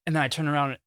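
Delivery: usual speaking voice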